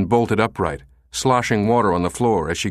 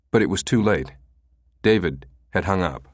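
usual speaking voice

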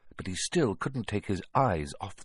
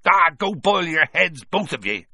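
raised, exasperated brush-off; loud dismissal; 8 semitones higher, 7 dB louder than usual. A narrator's reading. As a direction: shout them down impatiently. Giant dismisses the panicking pair.

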